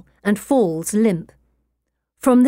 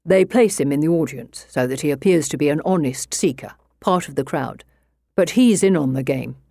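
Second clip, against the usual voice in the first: lower, measured gentleman inspector; a composed, skeptical English detective; 3 semitones lower than usual. Acted. Inspector voiced by narrator.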